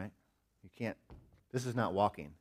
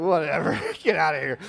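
laughing, louder mock dismissal; amused brush-off; 7 semitones higher, 10 dB louder than usual. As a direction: shoo him off fondly. Amused at son.

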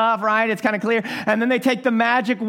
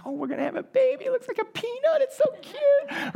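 put-on much higher, cooing over the baby; gushing excitement; 4 dB quieter than usual. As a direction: gush like a thrilled new dad, high and soft. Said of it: "and I'm really excited".

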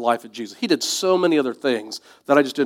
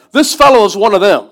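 put-on accusing servant girl; 5 semitones higher, 8 dB louder than usual.

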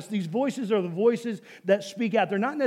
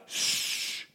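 long, forceful imitated "shh"; crowd shushing; 7 dB quieter than usual. Crowd shushing the blind men, imitated long hush. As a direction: hush them like an annoyed crowd. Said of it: "You have these people like".

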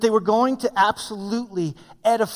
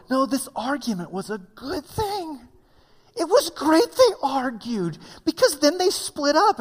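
mock preacher; 6 semitones higher than usual. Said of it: "I've always heard people preach this before".

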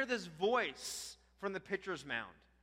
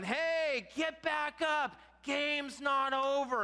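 high, loud, stretched-out mock holler; relatives yelling; 8 semitones higher, 5 dB louder, much slower than usual. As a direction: imitate relatives calling a player back. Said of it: "they're yelling".

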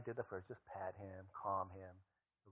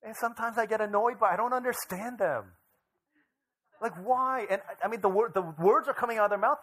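loud, high, fired-up preaching; preaching at full force; 12 semitones higher, 15 dB louder than usual. Acted. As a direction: preach it hard to the whole room. Preaching to the congregation.

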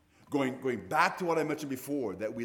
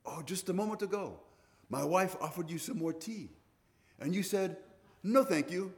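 higher-pitched retold explanation; earnest host; 7 semitones higher than usual. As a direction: lightly act out an earnest explainer. Acts out host explaining the incident.

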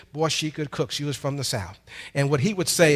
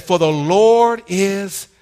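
slow, louder, stretched Lord then dropped good; drawn-out proclamation; 3 semitones higher, 5 dB louder, much slower than usual. Acted. Preacher proclaiming the psalm to the church, stretched loud. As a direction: hold the Lord long, then land good. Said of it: "I said".

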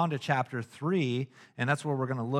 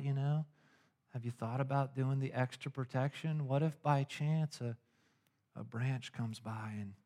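softer, coaxing upsell voice; hushed salesman pitch; 7 dB quieter than usual. Retold or imitated. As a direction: lean in and quietly push the extra protection. Pushy salesman upselling softly.

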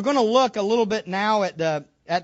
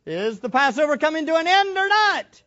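pressing louder question; 7 semitones higher, 6 dB louder than usual. Shown as a demonstration. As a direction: challenge the crowd, higher and louder. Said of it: "Oh, you out there".

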